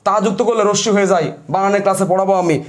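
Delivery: normal talking voice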